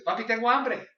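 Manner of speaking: high, loud call to dad; imitated daughter. Daughter tells her dad she is hungry, imitated daughter's call. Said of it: "mi hija, ¿no? Me dice a veces".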